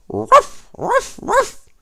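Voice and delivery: loud, high imitated barks; playful barking dog noise. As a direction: bark like a collie in a chase. Dog chases the cat.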